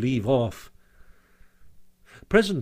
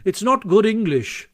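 higher, shocked objection; 8 semitones higher, 5 dB louder than usual. A narrator's reading. Rat objects to Toad's bad English, shocked. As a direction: protest primly in a raised pitch. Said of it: "greatly shocked".

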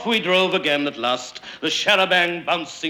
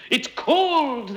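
high-pitched excited cry; 12 semitones higher than usual. A narrator's reading. Men paddling in the river, crying out high. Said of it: "they cried".

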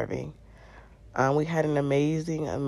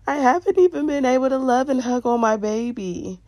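mock-sob it out, pitched way up; imitated crying; 10 semitones higher, 4 dB louder than usual. New mother crying to her boyfriend, imitated tearful wail. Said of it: "I just looked at him crying like".

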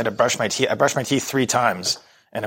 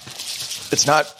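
talking with hiccups; much slower than usual. Real, not acted. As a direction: speak between hiccups. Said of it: "you got the hiccups now".

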